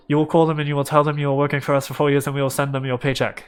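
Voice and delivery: very fast, run-together character voice; rapid-fire Liam. Acted. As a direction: rattle it all off in one rush. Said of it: "oh, this is Liam".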